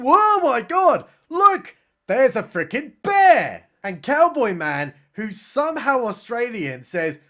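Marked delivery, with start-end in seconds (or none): 0.0-3.6 louder, higher, slow hammed-up alarm; mock alarm. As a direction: gasp it out like you just saw the bear.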